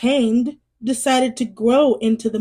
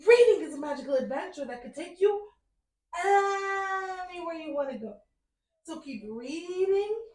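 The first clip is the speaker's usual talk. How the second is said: softer, lilting storyteller sign-off, half sung; sing-song outro; 4 semitones higher, 9 dB quieter than usual. Acted. Host bids her little bookworms farewell in a lilting sing-song. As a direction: croon goodbye to the little ones.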